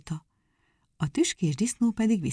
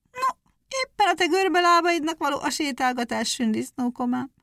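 mocking hare; 9 semitones higher than usual. Hare mocks the hedgehog's legs.

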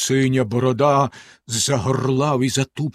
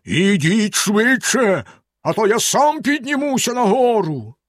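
villain's threatening bellow, pitched up; terrible yell; 8 semitones higher than usual. A narrator's reading. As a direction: roar a menacing order, stretching it out. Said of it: "заволав страшним голосом".

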